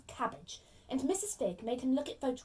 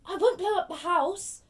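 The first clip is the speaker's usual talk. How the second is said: protesting; 8 semitones higher, 8 dB louder than usual. Acted. Boy protests the accusation.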